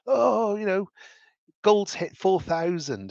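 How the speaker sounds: high, drawn-out wail without real sobs; mock crying. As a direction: imitate a distraught investor's whine. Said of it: "crying his eyes out".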